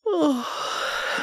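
high sighing oh. Acted. Lover waking the listener, high sighing oh.

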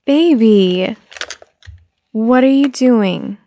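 startled scolding. Acted. Mother discovering her toddler with her makeup, startled and loud. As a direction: catch the child in the act, drawn out.

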